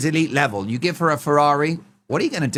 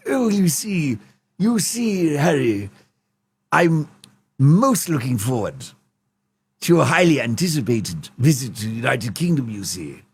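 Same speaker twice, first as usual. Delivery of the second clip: King Charles impression. King leaving Harry a voice note, posh and fussy. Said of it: "King Charles... leaving voice notes, going".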